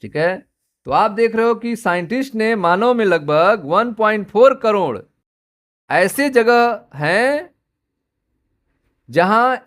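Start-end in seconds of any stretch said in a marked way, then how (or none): none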